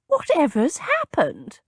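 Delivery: put-on fussing elderly cousin; Cousin Batilda voice. A narrator's reading. Cousin arriving, alarmed at the commotion.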